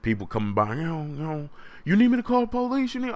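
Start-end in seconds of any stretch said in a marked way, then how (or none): 1.9-3.1 high, fast mock concerned passerby; hammed-up high bystander voice. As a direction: squeak it out like a worried stranger. Passerby offering to call police.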